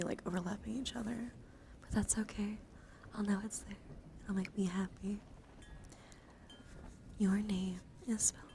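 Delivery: ordinary talk, nothing marked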